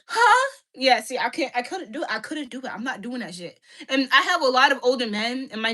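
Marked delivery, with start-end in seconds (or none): 0.1-0.5 a sudden high, loud "Huh?" of feigned confusion; mock startled huh. Pretending not to hear her man asking about lunch, mock surprised huh. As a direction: play dumb with a sharp high yelp of surprise. Acting out her reply to husband.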